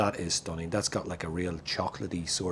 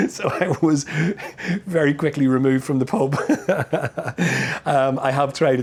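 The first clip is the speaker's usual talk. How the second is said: laughing; 6 semitones higher, 8 dB louder than usual. Real, not acted. Laughing at his own pub story.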